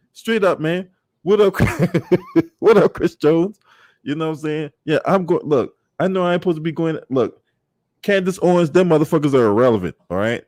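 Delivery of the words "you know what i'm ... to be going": usual speaking voice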